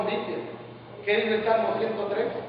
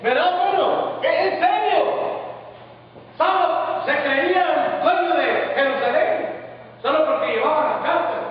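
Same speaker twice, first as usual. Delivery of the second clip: higher-pitched, forceful preacher delivery; impassioned preaching; 6 semitones higher than usual. Real, not acted. Preacher challenging the congregation about Saul's pride, forceful preaching.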